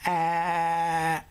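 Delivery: open-mouthed held ah; saying ahh. Shown as a demonstration. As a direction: open wide like at the dentist. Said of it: "open your mouth".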